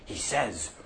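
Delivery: usual speaking voice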